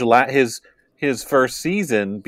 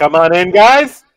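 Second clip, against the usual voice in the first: shouting up the stairs, higher and louder; yelling across the room; 5 semitones higher, 8 dB louder than usual. Retold or imitated. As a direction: call the kids from across the house. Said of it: "I often shout across the house".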